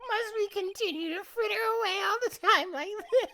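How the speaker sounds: high, fast put-on character voice; sneering high prosecutor voice. Voicing prosecutor while reading game.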